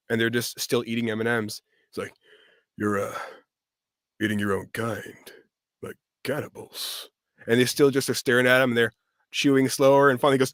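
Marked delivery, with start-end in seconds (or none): 2.8-7.1 low, halting deadpan, ending hushed; lightly voiced actor impression, trailing to a murmur; Patrick Warburton. Actor confronting candy characters, low halting deadpan. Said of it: "Patrick walks in".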